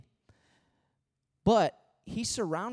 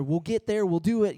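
pumped up, louder and higher; mock pep talk; 3 semitones higher, 6 dB louder than usual. Pastor parodies the hustling worker's self-pep, excited. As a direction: hype yourself up like a go-getter.

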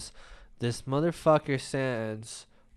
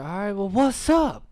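raised, high-pitched confrontational call; mock yell, pitched up; retold screaming challenge; 7 semitones higher, 4 dB louder, faster than usual. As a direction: re-enact calling someone out. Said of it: "Yeah, screaming".

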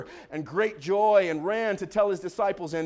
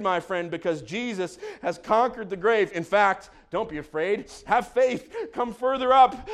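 ordinary talk

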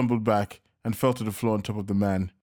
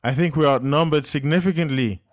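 loud, higher urgent reply; yelling back; 5 semitones higher, 5 dB louder than usual. A narrator's reading. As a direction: shout the bad news over gunfire. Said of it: "Joseph yelled back".

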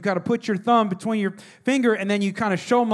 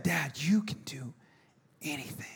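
awed whisper; 5 semitones lower, 10 dB quieter than usual. Retold or imitated. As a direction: whisper it in wonder. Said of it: "when he said".

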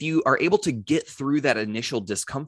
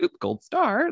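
playful award; slower than usual. Acted. Acts out handing gold star.

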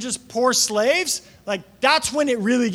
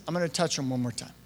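much softer, quick and easy; peaceful, calm; 4 semitones lower, 9 dB quieter, faster than usual. Retold or imitated. Preacher voicing a calm God, soft and unhurried. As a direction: say it softly like a small effortless thing. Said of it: "like, peaceful, calm".

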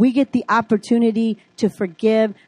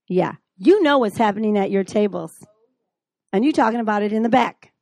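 ordinary delivery

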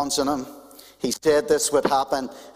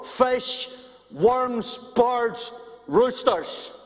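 preaching; 6 semitones higher, slower than usual. Acted. Preaching climax.

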